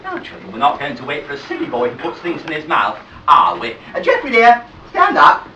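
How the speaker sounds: sweetly patient teacher coaxing toddlers; nursery school teacher. Acted. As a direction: talk to the little ones like a kind, firm nursery teacher.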